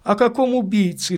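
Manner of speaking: quick, high, sharp demand; sharp questioning. A narrator's reading. Lady questioning the priest about a murderer, sharply. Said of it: "резко спросила она".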